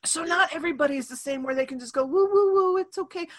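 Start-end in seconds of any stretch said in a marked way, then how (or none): 2.0-3.3 high singsong soothing, imitated; mock cooing comfort. Mimicking nurturing types soothing a child, mock cooing. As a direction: coo it like an overdone comforter.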